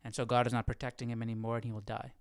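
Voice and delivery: ordinary delivery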